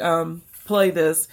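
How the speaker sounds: ordinary talk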